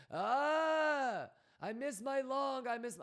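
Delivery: high, wailing mock whine, dragged out slowly; hammed-up whiny falsetto; whiny mock complaint. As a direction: mimic a trader crying about a missed long. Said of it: "motherfuckers telling me".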